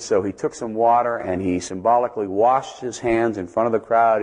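ordinary talk

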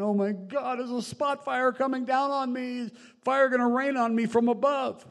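high, loud panicked cry, hammed up; imitating an alarmed, escalating wail; mock panic. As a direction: cry out like a frantic homeowner watching fire approach. Acting out a panicking fire-threatened resident.

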